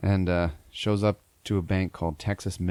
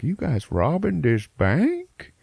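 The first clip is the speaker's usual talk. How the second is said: high, slow mock old-timer; imitating a slow-talking old man; hammed-up drawn-out geezer question; old man asking; 10 semitones higher, 3 dB louder, slower than usual. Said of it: "the guy's like".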